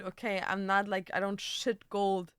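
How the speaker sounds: ordinary delivery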